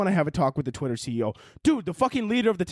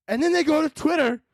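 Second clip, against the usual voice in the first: normal talking voice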